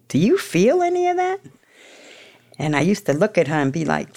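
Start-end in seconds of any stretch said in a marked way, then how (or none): 0.1-1.4 high, probing sponsor voice; imitating the sponsor. Sponsor asking her about feelings, high probing voice. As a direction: lift the voice way up like a prying sponsor.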